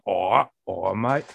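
retched-up British "mate"; rough attempt at British, brought up from the throat; British accent. Shown as a demonstration. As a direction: heave up a crude British accent. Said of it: "go in for the British accent, it sounds like the beginning of vomit".

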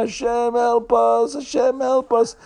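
acted crowd wail without full volume; mock screaming. Passengers on a wobbling ship cry to Hashem, mock screaming. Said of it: "everybody's screaming and screaming and screaming".

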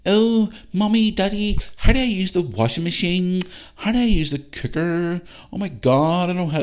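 high, whiny, pleading mock voice of a kid phoning home; helpless young adult. Dad mocking grown kids phoning parents for help, whiny high voice. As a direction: ham up a clueless kid begging Mommy and Daddy for help. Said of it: "on the phone every five minutes going".

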